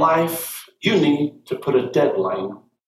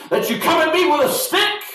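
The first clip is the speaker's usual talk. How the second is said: roared. A narrator's reading. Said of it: "he roared at David".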